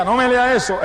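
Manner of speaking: retold protest, voice pitched well up; loud protest. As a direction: imitate a wife objecting out loud. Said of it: "en voz alta".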